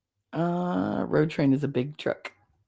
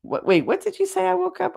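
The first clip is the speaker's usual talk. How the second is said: surprised double take. Real, not acted. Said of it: "I'm sorry I woke up all the fowl".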